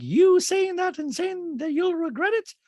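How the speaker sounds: high, drawn-out caricature voice; slow, loud falsetto mimicry; Dorit. Recapper mimicking a castmate questioning an apology, high and slow. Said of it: "and Dorit's like".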